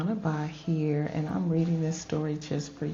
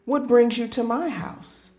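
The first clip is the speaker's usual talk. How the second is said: exclaiming; 6 semitones higher, 4 dB louder than usual. Acted. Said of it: "Amu exclaimed".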